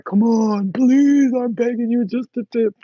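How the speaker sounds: high, loud whiny begging; begging man. Retold or imitated. Mocking how men beg for sex, high whiny plea. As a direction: plead like a desperate guy. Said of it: "you know how men are".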